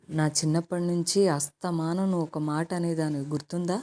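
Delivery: ordinary delivery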